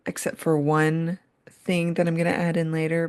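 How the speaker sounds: usual speaking voice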